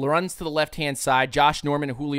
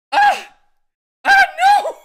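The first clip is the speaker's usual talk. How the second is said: hammed-up terrified shriek, much higher and louder; mock panic; 10 dB louder than usual. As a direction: imitate a defender panicking at a lineman. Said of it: "and he's like".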